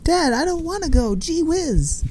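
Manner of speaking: put-on high whiny gee-whiz kid; wholesome 1950s kid. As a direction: play the innocent sitcom son. Son voiced in 1950s sketch.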